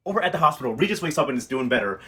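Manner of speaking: ordinary talk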